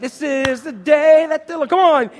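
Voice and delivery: loud, high, hammed-up cheer; over-the-top happy morning shout; mock jubilant. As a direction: shout it like a party starts.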